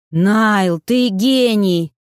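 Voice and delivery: loud, slow cry of delight; a crowd hailing a genius, loud and drawn out; rapturous shout. A narrator's reading. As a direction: cheer him like a crowd of fans. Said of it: "восторженным воплем".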